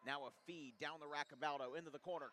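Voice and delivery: ordinary talk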